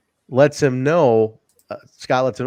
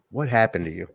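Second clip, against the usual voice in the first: soft, lower quoted question; quiet, heartfelt question; 4 semitones lower, 5 dB quieter than usual. Retold or imitated. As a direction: ask it gently into a silent arena. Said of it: "just quiet arena".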